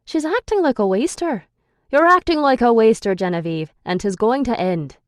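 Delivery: put-on frustrated man snapping at a lazy girl; angry frustration. A narrator's reading. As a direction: scold her like a furious guardian. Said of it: "the anger and frustration in his voice".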